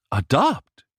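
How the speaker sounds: a high, disbelieving question; stunned. A narrator's reading. Mother hears her daughter will adopt, stunned high question. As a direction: echo the word in disbelief. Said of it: "Sylvia was stunned".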